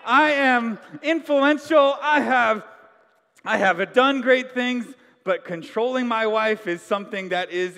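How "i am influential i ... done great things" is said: pompous man boasting. Retold or imitated. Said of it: "How many of you men go".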